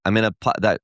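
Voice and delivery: ordinary talk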